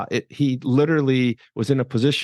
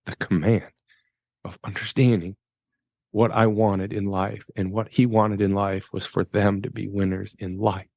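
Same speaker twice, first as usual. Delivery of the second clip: moved; 3 semitones lower than usual. Real, not acted. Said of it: "it's hard for me to get through that".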